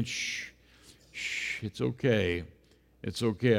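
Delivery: whispered shh, then a soft it's okay; calm a crying friend, barely voiced; soothing shush. Jesus soothing his frightened disciples, soft and hushed.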